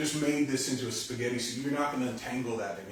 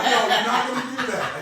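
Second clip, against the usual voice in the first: loud, high, laughing retraction; 11 semitones higher, 10 dB louder than usual. Real, not acted. Teacher dismissing their own joke response, laughing. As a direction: take it back while laughing.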